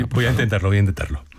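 usual speaking voice

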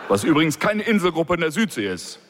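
ordinary delivery

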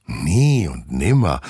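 slow, sing-song sworn promise; false promise. Acted. The girls promising the teacher, faking sincerity. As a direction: promise sweetly with fingers crossed. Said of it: "versprechen Nina und Steffi".

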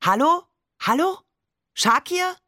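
shouting into a dead phone line; rising calls into the receiver; calling out. A narrator's reading. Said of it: "Barbe rief".

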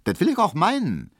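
high, brisk comeback; curt retort. A narrator's reading. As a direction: put him firmly in his place. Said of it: "gab Hedwig kurz zurück".

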